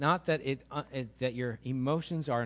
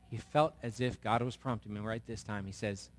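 ordinary delivery

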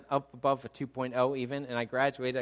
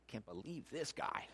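hushed imitation of a doubting listener; soft mock incredulity; muttered disbelief; 11 dB quieter than usual. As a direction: mutter it under your breath.

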